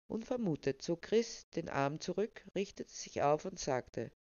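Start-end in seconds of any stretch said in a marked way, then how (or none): none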